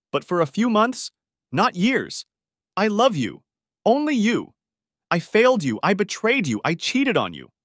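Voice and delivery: high, loud pleading cry; desperate outburst. A narrator's reading. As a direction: pour it all out at the top of the voice. Outburst after slamming fist.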